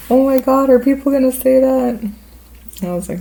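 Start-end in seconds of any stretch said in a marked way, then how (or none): 0.1-2.1 high, loud mock alarm; alarmed reaction. Friend reacting to community gossip, high and alarmed. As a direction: imitate a friend suddenly worried about gossip.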